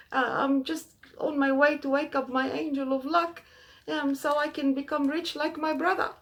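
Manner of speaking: high, begging plea before the king; frightened pleading. Acted. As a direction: plead with the king for mercy, scared. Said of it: "he was shaking".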